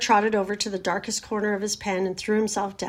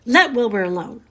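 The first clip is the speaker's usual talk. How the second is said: firm, louder scolding with a falling end; sharp rebuke; 7 dB louder than usual. A narrator's reading. Charlotte defends Wilbur to the lamb, sharply. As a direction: tell them off sharply. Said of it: "she spoke sharply to the lamb".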